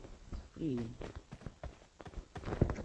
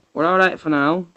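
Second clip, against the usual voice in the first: normal talking voice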